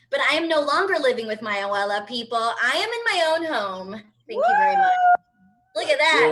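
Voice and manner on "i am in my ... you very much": loud high triumphant shout. Real, not acted. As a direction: yell it out proudly. Triumphant announcement to audience.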